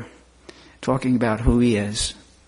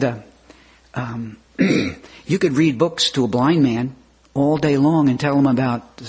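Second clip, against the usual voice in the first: usual speaking voice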